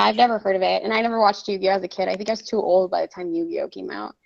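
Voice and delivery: normal talking voice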